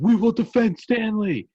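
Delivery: high, slow, far-off mock heroic cry; defiant townsfolk. Townsfolk shouting their vow to defend Stanley, mock heroic. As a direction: chant it like a proud local militia. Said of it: "And they're like".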